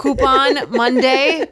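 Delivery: slow, drawn out through laughter; laughing. Real, not acted. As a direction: stretch it out while laughing.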